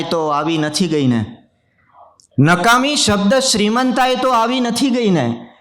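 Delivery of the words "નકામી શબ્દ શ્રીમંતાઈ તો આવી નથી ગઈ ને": much higher, declaimed repeat of the verse; ringing mushaira repeat; poetry recitation. Acted. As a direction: lift the line high for the audience. Repeats the couplet line for effect.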